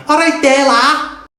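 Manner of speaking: high Scouse greeting; Scouse accent. Shown as a demonstration. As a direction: sing it up like a Liverpudlian. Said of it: "Scousers. They will say".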